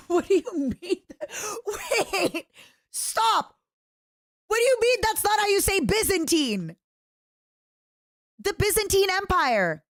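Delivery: high, laughing protest; laughing disbelief. Real, not acted. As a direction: squeal it out through laughter.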